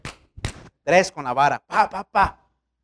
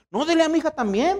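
mother insisting; 10 semitones higher than usual. Retold or imitated.

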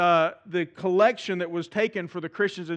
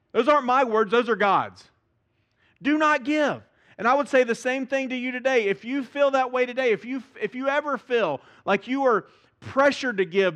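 preaching with force; 4 semitones higher, 3 dB louder than usual. Acted.

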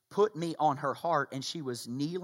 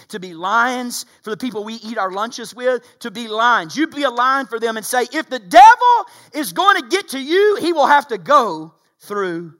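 loud, high, fervent sermon delivery; rising revival preacher cry; preaching at full force; 8 semitones higher, 15 dB louder than usual. Acted. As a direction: preach it hard to the whole room. Preacher rallies the congregation.

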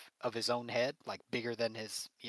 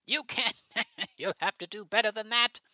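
much higher, louder mocking laugh; taunting villain; 10 semitones higher, 8 dB louder than usual. Villain mocking the missed cork shot, high and laughing. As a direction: laugh at their weak attack. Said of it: "He's like".

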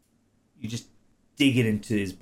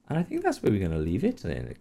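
ordinary talk

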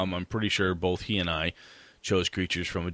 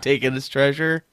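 put-on playful kid voice; 7 semitones higher, 6 dB louder than usual. Voicing a kid's answer.

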